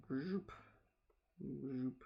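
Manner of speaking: soft, high little bubble sounds; playful bloop noises. Pretend. Streamer drawing bubbles, soft bloop sound effects. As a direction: pop out soft rising bloops.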